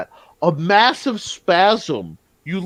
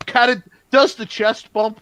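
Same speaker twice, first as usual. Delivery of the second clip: ordinary delivery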